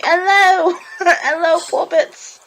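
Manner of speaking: high, hammed-up British hello; pretend British accent. Guest jokes about her fake accent in a hammed-up British voice. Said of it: "My awful pretend British accent".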